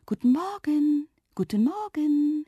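high, drawn-out singsong greeting; lilting high call of talking leaves; rustling poplar leaves. A narrator's reading. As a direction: greet like leaves in the wind, stretching the vowels. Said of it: "Die Blätter der Silberpappel rauschten".

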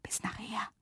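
soft secret whisper; hushed, whispered farewell. A narrator's reading. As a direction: whisper a goodbye very softly. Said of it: "und flüsterte".

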